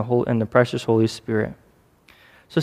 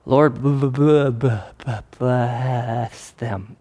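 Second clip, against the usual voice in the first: hammed-up stammer, stuck on the "b"; struggling to say it; much slower than usual. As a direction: force a blessing out through great reluctance, dragging it at the end. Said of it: "It was very hard".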